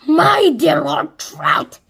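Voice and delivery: loud, slow mocking call; crying out. A narrator's reading. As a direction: cry it out with scorn. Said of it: "as Sponge cried out".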